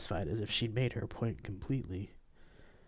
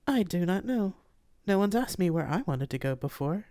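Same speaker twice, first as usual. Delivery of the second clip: higher polite AI voice; gentle formal ship AI; 8 semitones higher, 3 dB louder than usual. Acted. Nematona replies.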